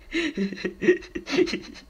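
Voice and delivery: high-pitched crazed giggle. Acted. Clown giggling after being questioned. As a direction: let a giggle escape.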